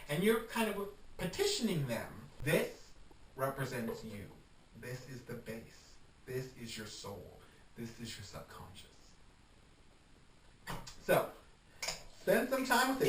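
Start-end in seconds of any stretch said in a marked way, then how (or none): 3.4-8.9 soft, breathy near-whisper to the herbs; hushed intimate voice. Acted. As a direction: lean in and murmur to the mixture like a secret. Speaking intimately to the herb mix.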